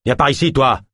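gruff guard barking; rough order. A narrator's reading. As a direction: drag him off roughly. Guard yanks the prisoner.

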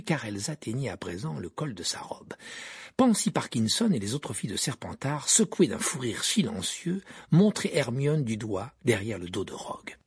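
ordinary delivery

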